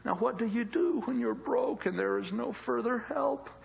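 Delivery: normal talking voice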